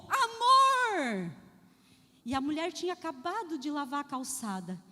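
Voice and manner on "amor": husband calling loud and high across the yard; shout. Retold or imitated. Farmer calls his wife from outside, shouting. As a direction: yell it from the gate. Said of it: "chegou em casa e gritou".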